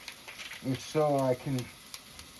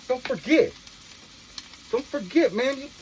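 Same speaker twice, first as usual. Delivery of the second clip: urgent plea; 9 semitones higher, 4 dB louder than usual. Real, not acted.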